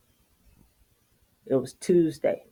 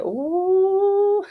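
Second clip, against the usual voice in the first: husband's shock. Retold or imitated. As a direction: whoop it in mock horror. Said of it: "He was like".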